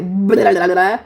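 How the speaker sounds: loud, run-together babble; mock someone talking on and on; imitating over-communication. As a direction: rattle off noisy blahs as mock chatter. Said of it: "you're either over communicating, like".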